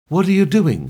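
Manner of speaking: high, loud question, lightly slurred. A narrator's reading. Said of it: "she slurred from her mouth".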